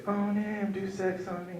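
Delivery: put-on high, soft girlish voice; breathy girl voice. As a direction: coo it like a lover. Girlfriend voiced in story.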